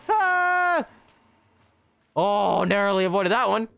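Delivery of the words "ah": high, drawn-out yelp; startled high cry; alarmed scream. Real, not acted. Player nearly hit in game, screaming high.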